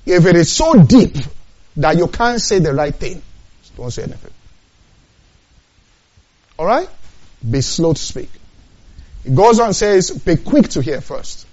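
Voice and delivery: loud, high preaching at full force; the preacher raising his voice to the congregation; fiery preaching. Acted.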